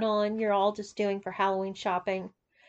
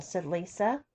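ordinary delivery

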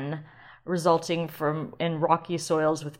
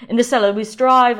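put-on British accent; 5 semitones higher, 12 dB louder than usual. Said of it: "they just became British".

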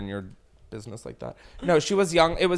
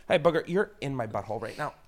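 normal talking voice

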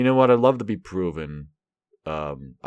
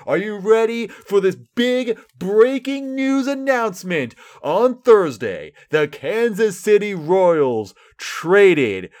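high, loud, slow hammed-up announcer build; mock breaking news; 7 semitones higher, 5 dB louder, slower than usual. Royals fan announcing a minor trade, sarcastic announcer hype. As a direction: sell a dud trade like huge news.

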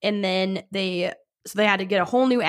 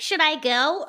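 mocking herself; 7 semitones higher than usual.

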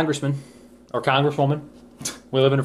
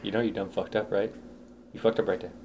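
much softer, lower, menacing taunt; menacing cop quote; 5 semitones lower, 11 dB quieter than usual. Retold or imitated. Quoting the cop taunting a suspect, soft and low. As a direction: lean in and needle him quietly.

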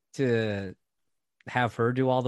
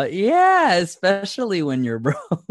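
laughing; 7 semitones higher, 8 dB louder, slower than usual. Real, not acted. Laughing agreement.

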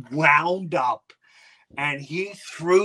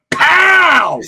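impact noise; 12 semitones higher, 11 dB louder than usual. Retold or imitated. And Jeffrey just went.

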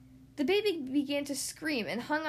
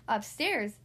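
plain reading voice

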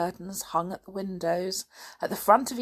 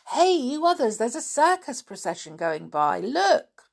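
higher, louder calling out; yell; 6 semitones higher, 4 dB louder than usual. Acted. George calling the others to see the circus, yelling. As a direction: call the others over, excited. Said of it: "gave a yell".

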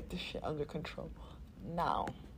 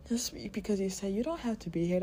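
ordinary delivery

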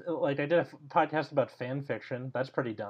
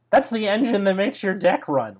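voice cracking; 8 semitones higher, 7 dB louder than usual. Real, not acted. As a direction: voice cracks high and loud. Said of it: "Why did my voice crack?".

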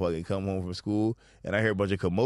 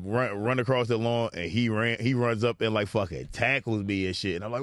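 usual speaking voice

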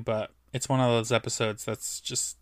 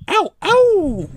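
two high, loud playful yelps; hammed-up high-pitched howling; mock howls; 9 dB louder than usual. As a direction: whoop twice, stretching the second. Joking howl after mock hype.

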